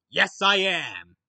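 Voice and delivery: loud, drawn-out excited yes; thrilled, emphatic exclamation. Real, not acted. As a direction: shout it out slowly with glee. Excited answer about movie trailer.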